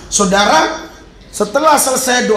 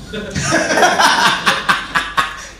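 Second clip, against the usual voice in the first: laughing; 4 semitones higher than usual. Real, not acted. Preacher laughing at his own joke to congregation, hearty laugh. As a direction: burst of breathy, voiceless laughter.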